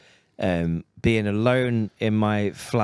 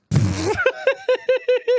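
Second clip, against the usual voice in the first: high laugh. Real, not acted. Laughs at own bit.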